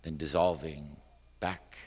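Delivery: measured recital, swelling then fading; slow down and let the last words fade away; poem reading. A narrator's reading. Poet watching Malta drift past, wistful poem reading.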